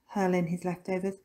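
plain reading voice

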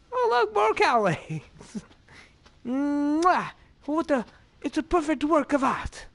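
high, loud, slow posh gush; mock art connoisseur. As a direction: gush like a fussy art critic. Mock admiring cow legs.

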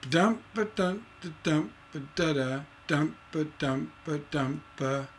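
rhythmic "DUMP, ba" chant, descending and stopping short on a weak "ba"; unresolved next line. Shown as a demonstration. Teacher letting listeners expect the next stress, unresolved chant. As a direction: chant the beats, cut off before the final "dump". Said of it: "I'm gonna give you the next line".

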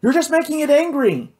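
high urgent warning call; shouting. A narrator's reading. Hal warns Cruz he's angering it, high urgent call. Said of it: "shouted Hal".

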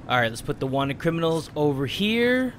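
ordinary delivery

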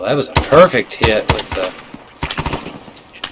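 ordinary delivery

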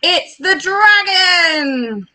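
slow, high, drawn-out announcement; dramatic reveal. Pretend. Revealing the dragon to the children, dramatic. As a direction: stretch it out as a big mock-scary reveal.

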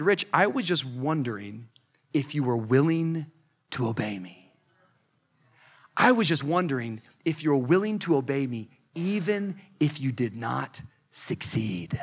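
quiet, patient divine words, spaced out; God's voice. Retold or imitated. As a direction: speak gently and deliberately as God. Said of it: "I heard God speak to me".

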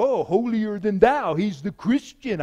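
slow, drawn-out sarcastic awe; mock reverence. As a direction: stretch it out in fake admiration.

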